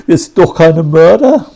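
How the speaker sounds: high and loud, aghast; horrified protest. A narrator's reading. Boy insists to the commissioner they aren't killers, horrified. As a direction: shout your innocence in shock. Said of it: "rief er entsetzt".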